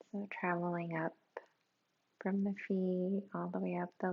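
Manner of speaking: very soft, hushed meditation voice with long pauses; guided meditation. Real, not acted. Teacher guiding listeners through a body scan, hushed and calm. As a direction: ease them inward, sinking toward a near whisper.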